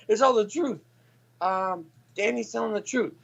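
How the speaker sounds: high put-on mom voice; mock mom. Imitating mom vouching for her son. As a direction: play the mom, high and fumbling.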